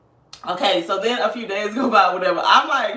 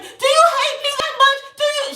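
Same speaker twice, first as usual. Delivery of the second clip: dramatic lady. Retold or imitated. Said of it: "she's like this".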